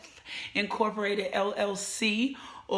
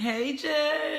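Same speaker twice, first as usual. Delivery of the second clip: higher, drawn-out hello; calling out a greeting; 5 semitones higher than usual. Real, not acted. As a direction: call out to a friend just arriving. Calling out greeting to arriving viewer.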